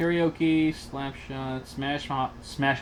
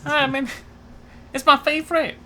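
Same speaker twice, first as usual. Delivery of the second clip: put-on Vietnamese accent; 2 semitones higher than usual. Co-host doing a punishment for the show, Vietnamese accent. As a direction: speak with an exaggerated Vietnamese accent. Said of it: "I will do a Vietnamese accent".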